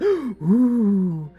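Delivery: normal talking voice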